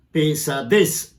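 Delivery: slow "pesadez" landing firmly on -dez; stress on last syllable. Shown as a demonstration. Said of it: "palabra aguda".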